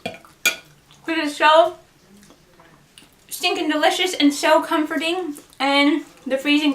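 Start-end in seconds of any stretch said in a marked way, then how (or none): 0.1-1.8 very slow, garbled around food; mouth full. Real, not acted. Chewing a second bite for viewers, mouth full. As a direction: drag it out while chewing.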